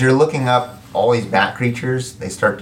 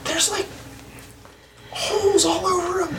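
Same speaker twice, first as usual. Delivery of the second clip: high squeaky voice. Acted. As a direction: squeak it out, grossed out. GM voicing a squeamish character.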